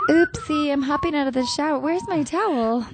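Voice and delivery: high squeaky bimbo voice; ditzy girl voice. Acted. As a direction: play a giggly airhead, way up high. Mock movie scene, bimbo role.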